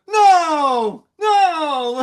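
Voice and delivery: high, loud, crying "no, no"; a horrified wail of protest; panicked denial. Retold or imitated. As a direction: yelp it out, mortified. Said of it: "like this".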